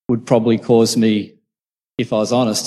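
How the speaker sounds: ordinary talk, nothing marked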